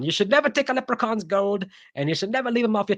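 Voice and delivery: high, impish little leprechaun voice. Pretend. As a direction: play the cheeky leprechaun warning. Leprechaun voiced in a horror countdown.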